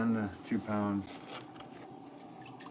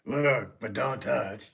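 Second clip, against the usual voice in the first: loud, higher hammy actor voice; Al Pacino; 3 semitones higher, 4 dB louder, much slower than usual. Pretend. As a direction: punch it out like a showy film star. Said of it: "Do Al Pacino".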